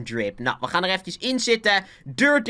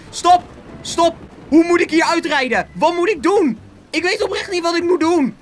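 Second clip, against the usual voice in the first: high, loud helpless panic; panicked; 10 semitones higher, 4 dB louder than usual. Real, not acted. Gamer not knowing what to do in the storm, panicked. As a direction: plead for it to stop, voice pitched way up.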